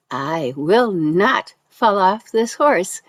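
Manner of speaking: low, slow, deliberate quote; imitating a rider's slow, low insistence; firm retort. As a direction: space the words out with firm assurance.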